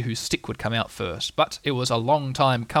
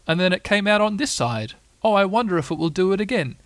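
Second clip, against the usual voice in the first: higher voice of a puzzled bear; wondering Pooh; 6 semitones higher than usual. Acted. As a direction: muse aloud in lifted, curious tones.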